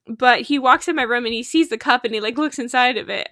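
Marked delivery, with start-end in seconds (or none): none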